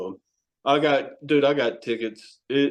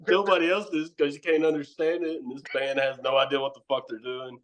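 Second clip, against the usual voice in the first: usual speaking voice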